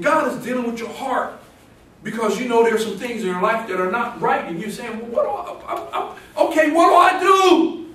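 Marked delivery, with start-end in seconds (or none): 5.0-7.9 high, stammering, building to a loud cry; acted desperate high-pitched asking; flustered mock plea. Acts out a convicted, flustered listener.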